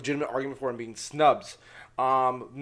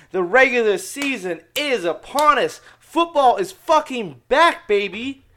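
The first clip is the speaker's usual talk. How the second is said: loud, raised, drawn-out hype; hyped announcement; 5 semitones higher, 5 dB louder, slower than usual. Real, not acted. Host announcing football season to listeners, loud and hyped. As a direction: stretch it out and punch it with excitement.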